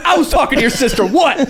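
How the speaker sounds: high-pitched retold yell; high, taunting mock shout; mock screaming. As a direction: imitate a drunk friend hollering a challenge. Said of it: "Like, just screaming".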